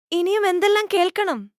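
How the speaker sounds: exasperated elephant. Acted. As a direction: throw it up high like the elephant has had enough. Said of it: "ഒരു ആന പറയുന്നത് കേൾക്കൂ".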